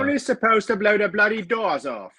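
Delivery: high, exaggerated Cockney movie-star line; Michael Caine impression. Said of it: "do you do a Michael Caine?".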